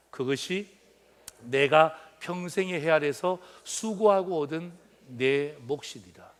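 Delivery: measured reading of the Bible verse with the church; scripture recitation. Acted. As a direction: read it aloud like a congregation in unison. Congregation reads scripture aloud. (성경 봉독하듯이)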